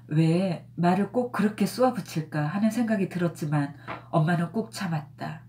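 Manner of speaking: plain reading voice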